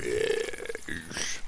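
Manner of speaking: low, breathy whisper of the name; a creepy creature hissing his name; eerie monster whisper. Acted. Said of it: "you just hear it continue to go".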